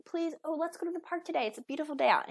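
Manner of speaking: put-on high, pleading kid voice; eager kids. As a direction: ask sweetly like a child hoping for the park. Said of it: "they run up to you and say".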